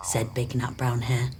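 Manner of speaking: usual speaking voice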